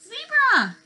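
high, loud triumphant shout; excited cheer. Acted. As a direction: burst out with the answer. Excited discovery for children.

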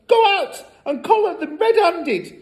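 very high, insistent mother; urgent hiss. A narrator's reading. Mother pushes her timid husband to act, frantic. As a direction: goad him on frantically. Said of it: "hissed the mother".

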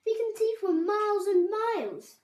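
high, loud wonder; calling out. A narrator's reading. Mouse shows Babbitty the view, excited call. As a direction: stretch it out in delighted awe. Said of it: "called Mouse".

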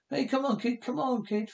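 high, strained coaxing; nervous coaxing. Acted. Begging the monster kid to calm down, nervous high coaxing. Pleading with the creature.

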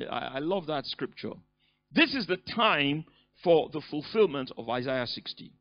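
normal talking voice

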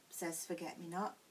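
usual speaking voice

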